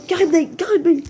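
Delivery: loud, high exclamation of the name; excited. Real, not acted. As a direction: blurt the name out, thrilled. J'étais trop content.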